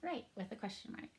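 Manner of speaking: ordinary talk, nothing marked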